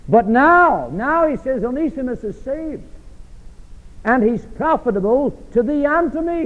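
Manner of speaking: high, ringing preacher's voice lifting the good news; rising preaching. Real, not acted. Preacher to congregation, ringing and joyful. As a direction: lift it high with joy. Preacher rises to full force.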